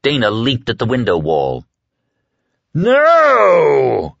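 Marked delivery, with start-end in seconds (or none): 2.7-4.2 high, loud scream. A narrator's reading. Dark man screaming as she escapes, furious. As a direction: yell it as she leaps. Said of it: "he screamed".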